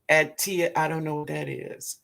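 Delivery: ordinary talk, nothing marked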